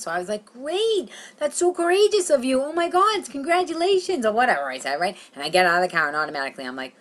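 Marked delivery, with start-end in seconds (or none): 0.4-4.3 high, gushing fake congratulations; put-on supportive. Comic reacting to friend coming out, put-on supportive. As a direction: gush high, overdoing the support. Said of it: "Act non-judgmental".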